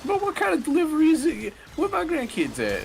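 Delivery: high, loud Southern grandma. Retold or imitated. Grandma demanding grandkids, high and loud. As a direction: squawk it like a sassy old granny. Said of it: "She's like".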